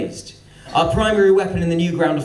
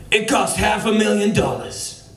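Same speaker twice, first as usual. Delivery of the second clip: put-on higher American boss accent, tightly held; American executive accent; 6 semitones higher than usual. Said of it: "the big American executive boss man".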